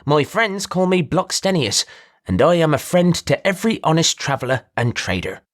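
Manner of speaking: a showy trader pinching his voice through the nose; nasally voice. Acted. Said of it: "In a nasally voice".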